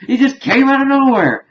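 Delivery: high, loud squeal of disbelief; mock outraged cry. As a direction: exclaim it as a stunned beaten opponent. Quoting stunned, beaten opponents.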